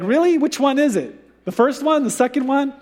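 baffled at the flip-flop, voice shooting up; bewildered disbelief. Pretend. Hezekiah imagined reacting to the reversed death sentence, incredulous. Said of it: "How would you feel?".

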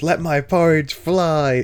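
high, drawn-out mock shout; Robin shouting. Small boy refusing his porridge, high mock shout. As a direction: declaim it like a child's grand defiance. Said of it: "And then he shouts".

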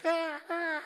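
high, hammed-up bird squawk; a bad imitation of an eagle cry that sounds like a dying crow. A preacher mimics an eagle's cry for the congregation, with a high mock squawk. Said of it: "That's my best eagle".

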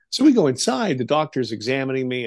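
ordinary talk, nothing marked

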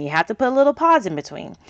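ordinary talk, nothing marked

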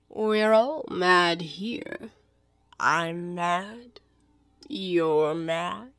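slow, elongated cat voice; Cheshire Cat. Acted. The cat tells Alice everyone here is mad, languid. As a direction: draw out each word, dropping after the first sentence.

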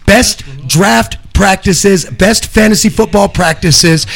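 slow segment-title announcer read. Acted. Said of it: "our first segment".

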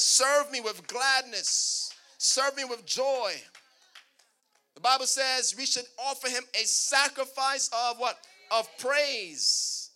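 loud, emphatic scripture proclamation; booming pulpit delivery; full-force preaching. Real, not acted. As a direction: proclaim it to the congregation, stretching key words.

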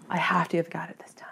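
hushed, quick whisper; whispered self-talk. Retold or imitated. Hunter sure she hit the bird, hushed whisper. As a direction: whisper it hopefully to yourself.

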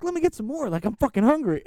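pleading, high-pitched and needy; whiny begging tone. Retold or imitated. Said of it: "he kinda had a little tone in, in his voice".

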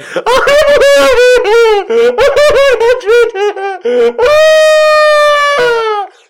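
loud high cackling laugh-scream; wild high laughter; hysterical shrieking laugh. Retold or imitated. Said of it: "I'd be like".

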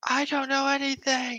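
high, soft terrified denial; frightened girl. Acted. NPC cracks under pressure.

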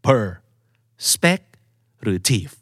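"per", "spec", "tive" as separate beats; quiz-style perspective, one syllable at a time. Shown as a demonstration. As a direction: split perspective into three choices with pauses. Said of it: "เน้นที่พยางค์ไหนครับ".

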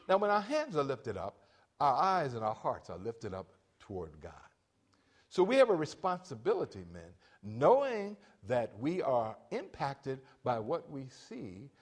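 ordinary talk, nothing marked

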